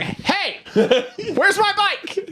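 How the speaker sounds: way up high, strained falsetto of a panicked man; upset game character. Retold or imitated. Bike owner demands his missing bike, high squeaky mock panic. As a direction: squeal it like he can't believe his bike is gone.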